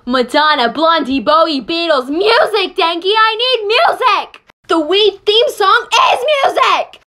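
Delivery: loud, high desperate rant; frantic shouting. Acted. Deprived of music, begs Denki, frantic shout. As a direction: yell it like you're going insane. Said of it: "Because I'm going insane".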